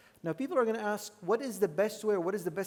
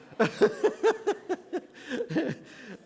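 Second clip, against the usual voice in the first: loud laugh; 10 semitones higher, 5 dB louder than usual. Real, not acted. Laughs at own joke.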